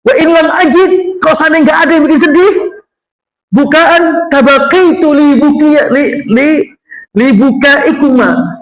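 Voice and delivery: a hint of a friend begging to share the grief; Umar pleading. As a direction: raise the voice and plead to cry along. Voicing Umar, raised pitch.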